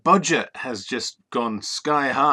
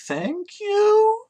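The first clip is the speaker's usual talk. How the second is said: high coy voice; 3 dB louder than usual. Pretend. Coy reply to compliment.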